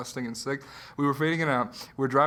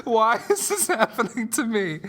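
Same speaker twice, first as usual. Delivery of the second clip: mock tearful plea, higher pitch; udawany płacz; 6 semitones higher than usual. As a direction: wail it lightly, played for laughs. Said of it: "and I was crying".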